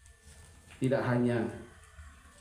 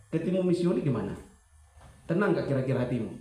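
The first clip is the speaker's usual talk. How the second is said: usual speaking voice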